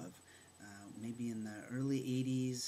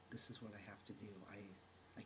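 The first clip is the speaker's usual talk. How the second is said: whispered thought; 2 semitones lower, 10 dB quieter, much faster than usual. Retold or imitated. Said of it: "and I thought".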